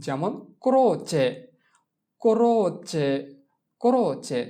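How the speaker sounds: ক্রোচে with a hard k-r, KRO-che; slow repeated teaching ক্রোচে; Italian pronunciation. Shown as a demonstration. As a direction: repeat ক্রোচে three times for the class. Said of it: "তাহলে হবে ক্র".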